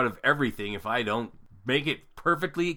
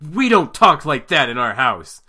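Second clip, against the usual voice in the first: loud retold parental scolding; mock angry; 2 semitones higher, 10 dB louder than usual. Wife telling off son for rude joke, loud mock anger. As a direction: scold with force, imitating a mad parent. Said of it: "my wife was really mad".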